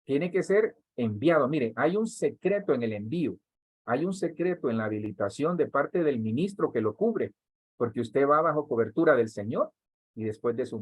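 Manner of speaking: ordinary delivery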